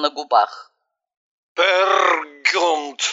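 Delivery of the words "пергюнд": put-on proud drunk proclamation; hammy stage actor. A narrator's reading. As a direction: announce it with a grin. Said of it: "с улыбкой пояснил Евгений".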